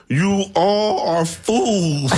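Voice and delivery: hammed-up, very slow pronouncement; Wolf Man. Quoting a werewolf movie at the gullible, hammed up. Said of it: "or the Wolf Man said".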